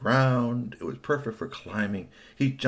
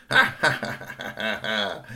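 acted ha-ha cackle; squirrels' laughter. A narrator's reading. Squirrels laugh while jumping.

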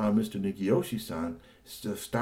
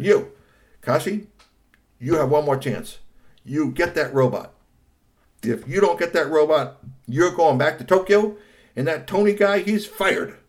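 high, louder stern ultimatum; stern threat; 7 semitones higher, 8 dB louder than usual. Acted. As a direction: lay down the law to nephew. Angry uncle threatens Kashi.

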